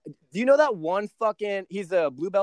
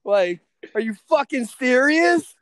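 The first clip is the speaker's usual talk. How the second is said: higher and louder, disbelieving rant; incredulous outburst; 8 semitones higher, 4 dB louder, slower than usual. Retold or imitated. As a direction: throw it at them like you can't believe it. Outraged rant at friends over weed.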